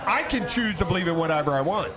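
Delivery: ordinary talk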